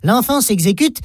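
ordinary delivery

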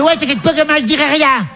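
high-pitched mum impression; imitated mother. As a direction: play the mother, pitched well above your own voice. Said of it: "Elle fait". (voix aiguë de la mère imitée)

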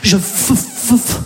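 stuck repeating the f; mock stammer; fake stutter. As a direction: pretend to stutter at the ticket counter. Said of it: "faire semblant de bégayer".

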